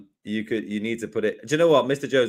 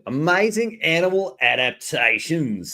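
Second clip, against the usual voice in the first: slow, higher-pitched Australian accent; Steve Irwin; 5 semitones higher than usual. Shown as a demonstration. Presenter answering colleague's request for an Australian accent, Steve Irwin. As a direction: stretch it out in a playful Australian accent. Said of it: "Or like a Steve Irwin voice".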